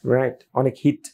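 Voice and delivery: ordinary talk, nothing marked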